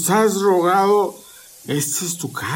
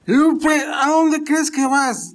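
sharp, much higher, slow demand; angry demand; 8 semitones higher than usual. Acted. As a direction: stop her cold, raising your voice. Drunk father stops daughter leaving.

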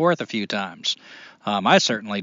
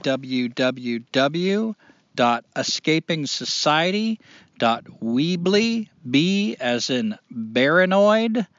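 slow dictation; 2 semitones higher, much slower than usual. Real, not acted. Slowly spelling out web address.

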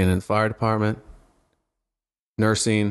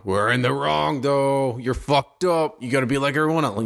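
mocking sheep voice; 5 semitones higher than usual. Mocking the conformist crowd.

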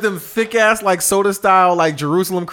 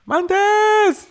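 put-on girl yelling a name; 14 semitones higher than usual. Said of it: "She talked like that".

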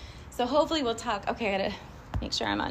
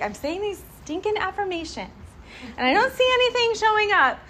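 mock exasperation, rising higher and louder; exasperated; 10 semitones higher, 8 dB louder than usual. Frustrated affirmation-sayer, mock exasperated and loud. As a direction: vent about the useless affirmations. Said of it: "you're like".

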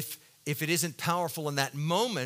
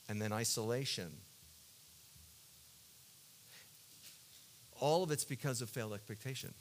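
quiet confessional preaching; 4 semitones lower, 6 dB quieter than usual. Acted. Preacher confessing his failed expectations to the congregation, soft and hushed. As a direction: draw them in close, almost hushed. Confessional low sermon passage.

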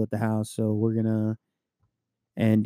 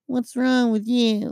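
high, slow scolding grandma; grandmother voice; 11 semitones higher, much slower than usual. Retold or imitated. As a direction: nag like an old grandma. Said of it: "my grandmother would be like".

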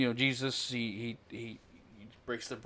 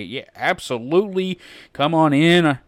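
eager welcome; 4 semitones higher, 7 dB louder than usual. Pretend. Imagined welcoming Jesus in.

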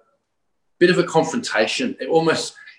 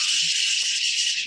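imitated fizzing hiss; 4 semitones higher than usual. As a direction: hiss out the crackle of sparklers. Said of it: "like, like this".

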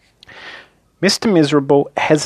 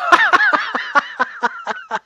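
huge booming laugh, pitched far higher; enormous laugh. Acted. Mister Miserable finally laughs, a huge booming laugh. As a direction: burst out in a giant hearty guffaw. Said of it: "a big, booming, hearty, huge, giant, large, enormous laugh".

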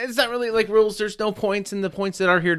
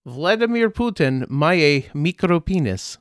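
mock Slavic accent; 2 semitones higher, 4 dB louder, slower than usual. Mock Ukrainian phrase.

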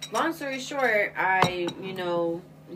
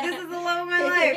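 excited gush; 8 semitones higher, 4 dB louder than usual. Retold or imitated. Swooning over Tinder match.

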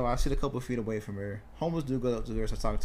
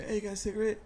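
lightly voiced homeless man, pitched up; homeless dude; 9 semitones higher, faster than usual. Homeless man asking a commuter for a cigarette, lightly imitated in a higher voice. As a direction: imitate a stranger asking, higher than usual. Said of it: "Homeless dude comes up to me and said".

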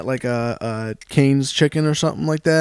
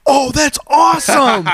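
high, drawn-out delight; mock excited brother; 10 semitones higher, slower than usual. Brother hears of the motorcycle course, mock excitement. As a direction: imitate a thrilled brother.

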